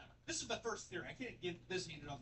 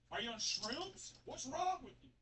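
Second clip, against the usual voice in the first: mock snap; 10 semitones higher, slower than usual. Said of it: "he finally snaps at her and says".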